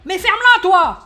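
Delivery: high, loud shut-up; snapping interruption. Acted. Cerise cutting off the loudmouth, loud high retort. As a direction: cut him off loudly. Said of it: "Cerise qui l'interrompt".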